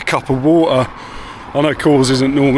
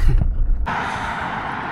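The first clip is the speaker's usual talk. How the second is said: laugh; 5 dB quieter than usual. Real, not acted. Laughs at parking attempt.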